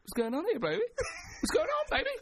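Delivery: high-pitched, laughing mock challenge; confrontational Simba. Lion getting up to face the geezer, comic confrontation. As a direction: square up in a silly high voice. Acting Simba squaring up to the man.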